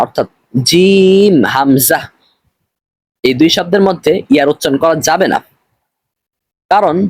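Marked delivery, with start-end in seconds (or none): 0.7-2.0 very long "জিম", then "হামজা" with no ya; correct careful reading. Shown as a demonstration. Teacher restating the rule, "জিম" drawn out before "হামজা". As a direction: hold "জিম" slowly, end on "হামজা". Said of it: "এখানে ইয়ার উচ্চারণ করা যাবে না".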